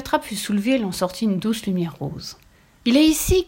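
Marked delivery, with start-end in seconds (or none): 2.8-3.4 high, loud cry of discovery; shouting. A narrator's reading. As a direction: call it out loudly. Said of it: "cria le Soleil". (cri fort et aigu)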